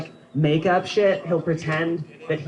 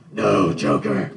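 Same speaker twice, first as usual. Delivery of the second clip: much lower slow growl; Batman voice; 10 semitones lower, 3 dB louder, much slower than usual. Retold or imitated. Batman answering the Joker, slow deep growl. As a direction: drag it out in gravel. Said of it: "my Batman".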